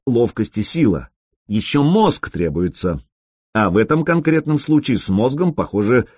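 plain reading voice

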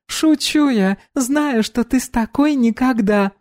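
put-on bright high young woman; high girl voice. Dominika voiced.

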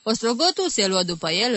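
usual speaking voice

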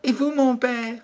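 very high, sweet princess voice; dutiful princess. A narrator's reading. Princess answering her father the king, dutiful. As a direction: answer high, as a devoted daughter.

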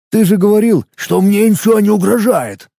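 high mocking parody voice; mocking mimicry. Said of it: "Последний исказил, передразнивая его".

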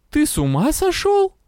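high-pitched, climbing incredulous question. A narrator's reading. Witch confronting the man at the door, high incredulous question. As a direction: pitch leaping up in disbelief. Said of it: "тихий вопрос".

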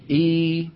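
إي held as a long ee; long ee vowel. Shown as a demonstration. Teacher modelling the lengthened ee for the class, held. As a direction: stretch إي into a long ee. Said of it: "وبدلا من أن يقولوا إ يقولون".

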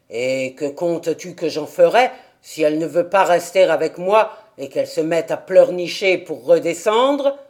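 put-on low, surly old man's voice; gruff old man. A narrator's reading. Old man asks Dete why the child came, low and surly. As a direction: grumble like a hermit disturbed.

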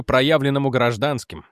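ordinary delivery

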